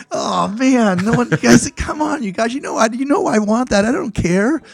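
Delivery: high, whiny pleading; hammed-up high whine, begging his friends; mock whine. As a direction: beg the guys like a kid denied a toy.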